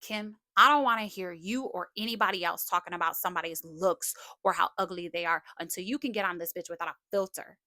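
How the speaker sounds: normal talking voice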